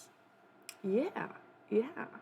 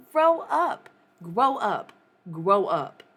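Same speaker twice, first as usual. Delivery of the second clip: loud scolding; 4 semitones higher, 11 dB louder, slower than usual. Real, not acted. Repeated scolding.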